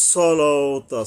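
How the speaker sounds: loud, careful সলাওতা with lengthened laa; one-alif elongation. Shown as a demonstration. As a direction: articulate সলাওতা loudly, stretching the middle. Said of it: "লামের উপরে এক আলিফ টানতে হবে". (সলাওতা জোরে, লাম টেনে)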